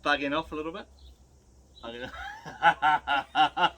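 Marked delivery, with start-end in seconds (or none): 1.8-3.7 very slow with a laugh; laughing pun. Real, not acted. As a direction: stretch it out, laughing at your own joke. Repeating the pun as a dad joke.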